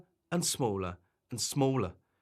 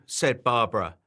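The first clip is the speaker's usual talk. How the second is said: usual speaking voice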